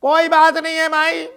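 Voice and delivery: high, loud wheedling beggar; cheeky beggar pleading; beggar's whine. Acted. Beggar tells the lady to give him a missed call, whining. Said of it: "beggar replies".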